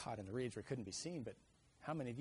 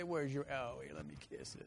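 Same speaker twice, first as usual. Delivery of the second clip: tender mom. Retold or imitated. Mom comforting child.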